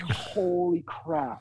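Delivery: slow, stunned disbelief; a slowed-down mock gasp of disbelief. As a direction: let the shock stretch it out. Stunned realization about Devo's agent.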